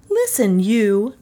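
high-pitched, commanding woman's voice; indignant woman speaking up; loud and clear. A narrator's reading. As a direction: demand attention, high and clearly spoken. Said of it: "she said loudly and clearly".

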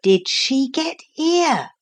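put-on a scared boy hiding in bushes; frightened boy. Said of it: "Philip wondered to himself".

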